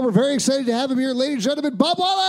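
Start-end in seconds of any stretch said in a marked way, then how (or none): 1.1-2.2 shouted big guest intro; announcer shout. Real, not acted. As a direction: build up and yell the name.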